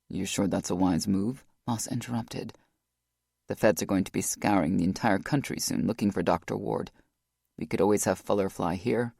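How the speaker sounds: deep, measured big-guy voice; Boss, low and steady. A narrator's reading.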